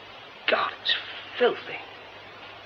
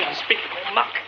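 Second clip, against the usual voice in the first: ordinary talk, nothing marked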